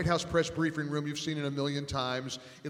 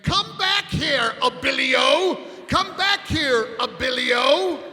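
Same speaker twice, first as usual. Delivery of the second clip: much higher, slow, much louder mock shout; Gorka bellowing; 10 semitones higher, 9 dB louder, slower than usual. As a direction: drag out each word as a furious bellow after him. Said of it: "Seb starts saying".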